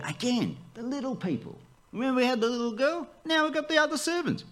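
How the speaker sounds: playful high aside. Acted. Playful aside about servants.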